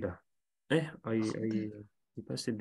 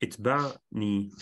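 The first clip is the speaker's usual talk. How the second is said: slow demo; much slower than usual. Shown as a demonstration. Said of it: "the command verb".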